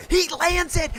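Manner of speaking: first burst of disbelief at the landing; excited call. Real, not acted.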